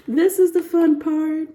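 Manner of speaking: high, slow, giddy with glee; excited giggle. Real, not acted. Tarot reader gleefully teasing viewers before the love reading, giddy. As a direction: savour it like a treat.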